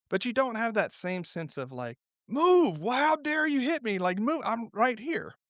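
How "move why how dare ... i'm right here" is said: hammed-up indignation at a boat that bumped you; indignant outburst. Acted outrage at bumping boat.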